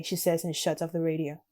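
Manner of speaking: usual speaking voice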